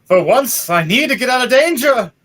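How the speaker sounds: high, loud heroic shout; Leiden shouting. Acted. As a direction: yell it mid-backflip.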